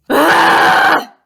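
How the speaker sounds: loud, high, long angry "ah"; sustained scream of anger. Shown as a demonstration. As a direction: let out a big demo scream. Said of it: "Sometimes you scream when you're angry".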